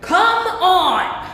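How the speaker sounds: loud, high frustrated cry; exasperated shout. Acted. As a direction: yell at the noise that ruined the moment. Bagpipes interrupt the kiss.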